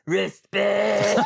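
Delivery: loud high shouted respect; Booker T shout. Retold or imitated. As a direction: bark it out from the background. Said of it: "you just hear in the background".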